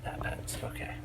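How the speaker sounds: hushed whisper. Real, not acted. Reacting to the store announcement while hiding, whispering. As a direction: breathe it out without voice.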